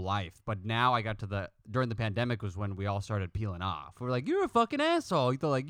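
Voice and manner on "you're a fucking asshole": high, hammed-up fight; mock angry insult. Friends splitting in the pandemic, high mock angry insult. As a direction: fling it like a mock row.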